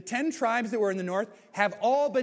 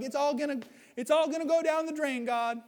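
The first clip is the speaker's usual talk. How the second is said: much higher, whining panic; despairing whine; 9 semitones higher than usual. Retold or imitated. Elijah tells God it is all going down the drain, high whine. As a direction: wail that everything is lost. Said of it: "his next response is like".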